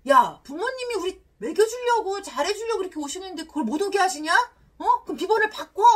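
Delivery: high, loud challenge to a spouse; indignant scolding. Shown as a demonstration. Husband defending parents' visits to spouse, indignant scolding. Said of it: "막 이렇게 막 하기보다".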